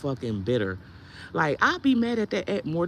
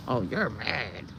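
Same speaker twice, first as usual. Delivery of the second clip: low, mocking critic's voice; 7 semitones lower, 3 dB quieter, much slower than usual. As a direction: sneer it like a dismissive critic.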